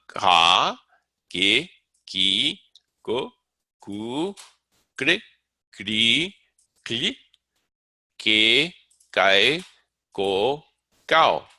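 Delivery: ka, ke, ki sounded out one by one; syllable drill. Shown as a demonstration. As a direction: pause after each syllable like a chart drill. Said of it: "you can also make it followed by".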